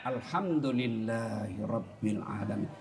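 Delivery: low, soft, drawn-out praise; slow, heartfelt. Shown as a demonstration. Preacher showing how to say praise with an open heart, slow and soft. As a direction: say it slowly, letting it sink in. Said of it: "Coba ucapkan dengan lapang dada".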